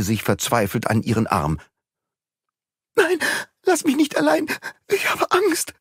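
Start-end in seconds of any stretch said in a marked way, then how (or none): 2.9-5.7 high, desperate begging; pleading in fear. Acted. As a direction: beg not to be left. Suzanne clings, begs not alone.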